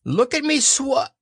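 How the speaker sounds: high squeaky show-off; excited little child. A narrator's reading. Roo shows off in the pool, excited squeak. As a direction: pipe up high, words cut short by water. Child splashing, showing off in water.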